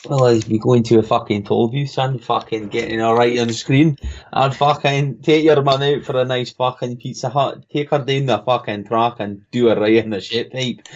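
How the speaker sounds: rowdy Scottish lad's banter; Scottish accent. Shown as a demonstration. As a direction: put on a Scottish brogue. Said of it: "in a Scottish accent".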